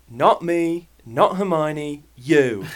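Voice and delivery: hammed-up British schoolboy accent. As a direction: put on a British accent for Ron's line. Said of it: "I'll do it in a British accent".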